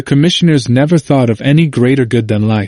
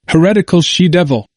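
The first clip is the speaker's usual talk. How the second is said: higher, sharp exclamation of contempt; exclaiming insult; 6 semitones higher than usual. A narrator's reading. Magistrates denouncing the wife, with a pitched-up angry exclamation. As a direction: fling the insult at her, pitched up. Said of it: "they exclaimed".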